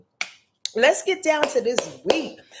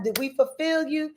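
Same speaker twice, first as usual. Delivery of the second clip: normal talking voice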